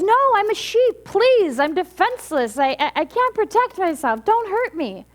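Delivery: high, loud start, easing a little; frightened pleading. Acted. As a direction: beg as a defenseless little cub. Little lion pleads again.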